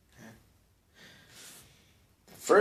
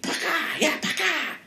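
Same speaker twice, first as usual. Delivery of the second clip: much higher, "paka" spat out like a pop; mock pop noise. Teller acting out pulling the redcoat's shoe, high pop noise. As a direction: make "paka" a sharp cartoon pop. Imitating a popping sound.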